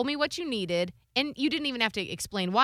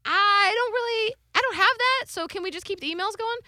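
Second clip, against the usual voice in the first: high-pitched, tentative retold reply; hesitant reply; 9 semitones higher than usual. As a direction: back out gingerly, voice lifted high. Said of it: "I was like".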